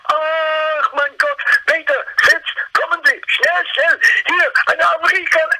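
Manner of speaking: high-pitched German customs officer in alarm; mock panicked shriek. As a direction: shriek for your colleagues in mock horror. Customs officer opens box, panics.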